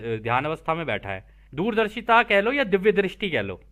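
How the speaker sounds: normal talking voice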